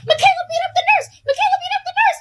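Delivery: very high-pitched mock shouting; excited kids' yell. Witnesses running to classroom doors with news, excited high yell. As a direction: spread the news like shrieking schoolkids. Kids yelling news down the halls.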